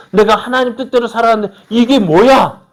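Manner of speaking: loud, high-pitched outburst of complaint; acted indignant protest. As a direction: cry out in mock outrage at God. 실망한 신자의 항변을 흉내. (높고 큰 소리로 따지듯 항변)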